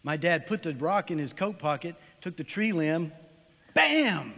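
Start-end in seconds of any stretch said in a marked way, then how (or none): 3.8-4.3 high, loud "bam!"; preacher acting out the strike, sudden and loud; loud sound effect. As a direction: slam it like a blow. Dad strikes the dog.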